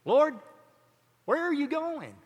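high, whining plea; whiny voice. Retold or imitated. Peter speaks up to his Lord, whiny. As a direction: put on a whiny disciple, pitched well up. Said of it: "hear it in a whiny voice".

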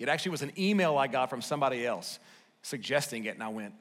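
ordinary talk, nothing marked

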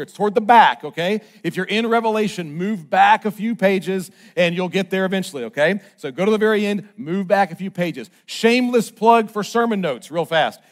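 ordinary talk, nothing marked

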